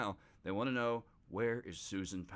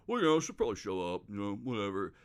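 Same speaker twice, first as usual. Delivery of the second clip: careless shrug; 4 dB louder, faster than usual. Retold or imitated. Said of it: "And he's like".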